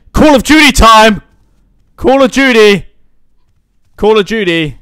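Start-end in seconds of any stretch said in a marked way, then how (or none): 0.1-2.9 loud, high announcing shout; excited cheer. Pretend. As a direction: call it out like a hyped gamer. Cheers finding the video game.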